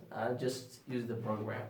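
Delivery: normal talking voice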